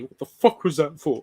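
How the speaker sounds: high, loud startled voice; mock outraged quote. Friend quoting a meme clip, mock outraged. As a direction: blurt it out, indignant and shocked.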